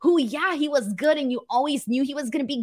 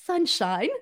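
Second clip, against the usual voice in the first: attempted English accent; William Regal; 2 semitones higher, much slower than usual. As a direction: try a light, shaky-footed English accent. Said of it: "Denise Regal".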